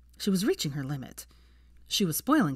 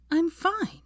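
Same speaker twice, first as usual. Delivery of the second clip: short, sharp and much higher; terse; 12 semitones higher, 4 dB louder than usual. Acted. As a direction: snap it off, cutting him short. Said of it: "she said tersely".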